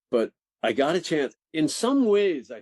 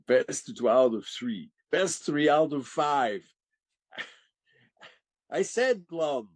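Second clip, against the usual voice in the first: sore-loser Death, climbing higher as he insists; spoiled, petulant Grim Reaper; 5 semitones higher than usual. Acted. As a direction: play a comic tantrum in a Czechoslovakian accent. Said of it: "like the spoiled, petulant, little, little shit".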